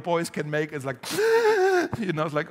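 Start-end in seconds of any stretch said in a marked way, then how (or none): 1.0-1.9 high, loud imitated child's shriek; piercing wail. Preacher mimicking a lost five-year-old, piercing high wail. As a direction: let out a little boy's piercing squeal. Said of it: "make this piercing noise".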